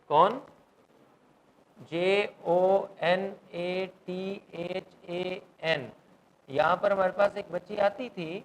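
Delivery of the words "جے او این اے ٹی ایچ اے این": slow, evenly spaced letters; spelling out. Shown as a demonstration. Teacher spelling the writer's name for students, slow letter by letter. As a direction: say it letter by letter with gaps.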